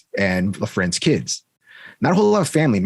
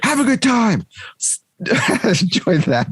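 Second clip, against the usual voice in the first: mock sweet send-off; 12 semitones higher, much slower than usual.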